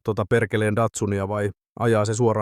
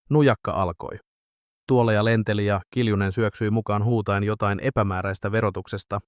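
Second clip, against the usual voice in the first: ordinary delivery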